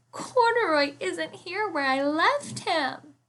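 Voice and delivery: put-on high child voice, slow and worried; a small girl near tears; little girl tells her mother the bear is gone, high and worried; upset little girl. Lisa's distressed voice.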